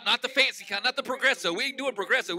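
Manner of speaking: normal talking voice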